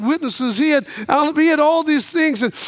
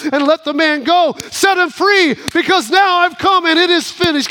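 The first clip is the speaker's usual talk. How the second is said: triumphant proclamation; 3 semitones higher, 3 dB louder than usual. Acted. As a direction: build to a loud, high declaration. Judge acted, proclaims man's release.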